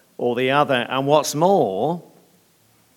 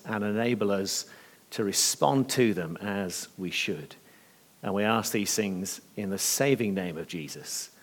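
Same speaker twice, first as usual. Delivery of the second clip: soft, lowered praying voice; earnest prayer, growing softer towards the amen; 3 semitones lower, 5 dB quieter than usual. Real, not acted. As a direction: lead the congregation quietly in prayer. Leads the congregation in prayer.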